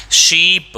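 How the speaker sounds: "शीप" loud, with a long ee; long vowel in शीप. Shown as a demonstration. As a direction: stretch the ee in "शीप". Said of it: "श में ई की मात्रा".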